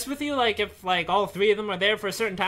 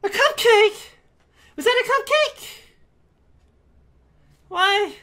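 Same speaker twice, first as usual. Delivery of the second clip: much higher, louder, incredulous; shocked disbelief; 13 semitones higher, 6 dB louder, slower than usual. Real, not acted. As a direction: still reeling from the scare. Reacting to the jump scare.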